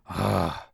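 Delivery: low, heavy exhaled groan; acted sigh of reluctance; reluctant sigh. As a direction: sigh at a daunting demand. Acts the reluctant rich young ruler.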